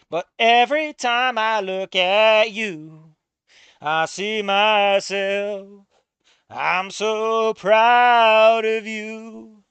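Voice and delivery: slow, loud, hammed-up heartfelt speech; mock dramatic reading. Streamer reading the game's father lines, hammed-up and slow. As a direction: drag each word out like a melodramatic hero.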